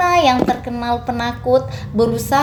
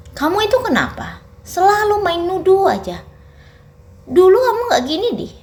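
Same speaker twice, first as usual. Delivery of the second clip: higher, rising irritation at the wife; exasperated scolding; 9 semitones higher than usual. A narrator's reading. Husband Fatan rebukes his wife, exasperated and higher. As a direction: scold her for always accusing.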